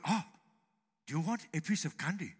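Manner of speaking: soft, quick Kung Fu sage impression; David Carradine. Preacher imitating Kung Fu star, hushed sage voice. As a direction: say something trivial as if it were wise. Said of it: "he could say most anything, uh, and it would sound good".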